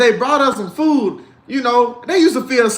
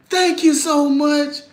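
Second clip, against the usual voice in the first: polite mama; 6 semitones higher, slower than usual. Retold or imitated. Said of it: "My mama".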